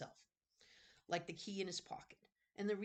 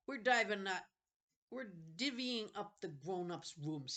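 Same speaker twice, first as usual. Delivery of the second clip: smug bully; 5 semitones higher, 5 dB louder, slower than usual. Acted. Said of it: "Sebastian sniffed".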